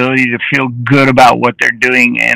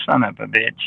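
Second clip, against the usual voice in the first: muttered version; 5 semitones lower, 8 dB quieter than usual. Retold or imitated. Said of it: "says it in different ways".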